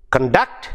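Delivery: conDUCT, rising onto the second syllable; light first syllable, hit DUCT in conduct; second-syllable stress. Shown as a demonstration. Teacher showing the verb to students, second-syllable stress on conduct. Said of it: "You see the difference?".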